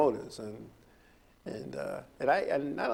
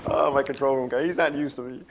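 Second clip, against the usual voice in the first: chuckling while talking, louder; laughing; 2 semitones higher, 4 dB louder than usual. Real, not acted. Host teasing his control room guy, laughing. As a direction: talk through real laughter.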